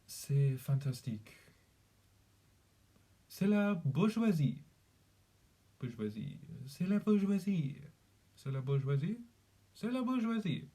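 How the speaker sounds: French phrases with swooping stress; exaggerated French practice phrases; French accent. Shown as a demonstration. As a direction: play up the French, lilting up and down. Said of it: "That's about as far as the French goes".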